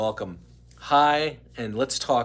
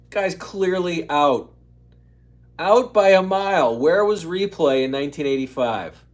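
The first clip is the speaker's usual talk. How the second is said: louder, indignant fan protest; fan outrage; 6 semitones higher, 8 dB louder than usual. Real, not acted. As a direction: rail at the blown call. Fan outraged at old umpire call.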